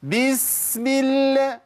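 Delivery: high, very slow "بسم" with a long hiss; exaggerated chanted "بسم"; overdoing it freely. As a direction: mimic a showy reciter stretching the s of "بسم". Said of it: "يزود براحته".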